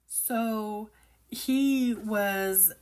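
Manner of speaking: ordinary talk, nothing marked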